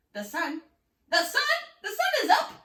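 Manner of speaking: building from a question to a loud high cry; panicked shout. A narrator's reading. Klaus seeing the sunrise, building to a panicked shout. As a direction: realise too late and panic. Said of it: "Klaus shouted with panic".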